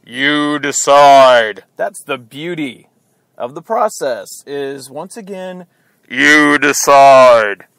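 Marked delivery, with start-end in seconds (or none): 0.0-1.6 slow, loud, drawn-out movie-trailer call; booming announcer. Acted. Host telling Ohio voters the choice is theirs, booming. 6.1-7.7 slow, loud, drawn-out movie-trailer call; booming announcer. Acted. Host telling Ohio voters the choice is theirs, booming.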